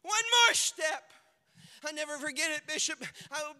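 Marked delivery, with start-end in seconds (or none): 0.1-1.0 loud, high preaching cry; shouted climax. Acted. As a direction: hit it hard and high.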